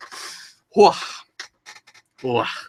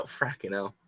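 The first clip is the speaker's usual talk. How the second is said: muttered imagined reaction; 6 semitones lower, 14 dB quieter, slower than usual. Retold or imitated. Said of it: "he's probably like".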